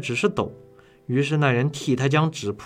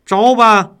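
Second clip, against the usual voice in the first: much higher, loud demand; loud shout; 8 semitones higher, 12 dB louder than usual. A narrator's reading. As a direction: shout the order down at him. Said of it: "长衫人物也大声说".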